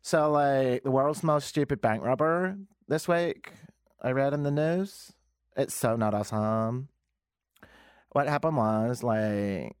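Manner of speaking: mock King West Toronto accent; put-on Canadian accent, drawn-out "like". Shown as a demonstration. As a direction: parody a trendy Toronto talker telling the news. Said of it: "This is how everyone talks".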